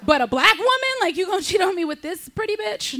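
high, incredulous mock outrage; mock indignant. Comic mocking the husband's choice, high indignant squeal. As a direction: squeal it in disbelief.

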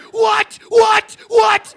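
high shouted what; shocked crowd shout. Retold or imitated. Comic voicing the audience, shouting. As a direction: yell it like a stunned audience. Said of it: "It was".

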